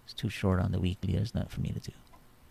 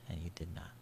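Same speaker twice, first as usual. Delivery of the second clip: whispered; 3 semitones lower, 13 dB quieter, faster than usual. Real, not acted. Quiet aside about a trade.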